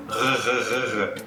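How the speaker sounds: throaty rolled R sound; a repeated throaty R; guttural R sound. Shown as a demonstration. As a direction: rasp the R in the throat. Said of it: "Esse R mais ou menos gutural".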